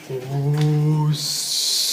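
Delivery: low, soft hum turning into a hiss; ominous machine noises. Retold or imitated. Storyteller imitating the time machine, ominous machine noises. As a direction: imitate a sinister machine humming and venting. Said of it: "it's making really ominous noises".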